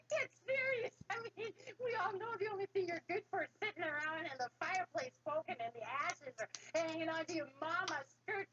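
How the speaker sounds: high, mocking, laughing brothers; teasing brothers. A narrator's reading. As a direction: jeer at the little brother. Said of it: "the brothers teased him".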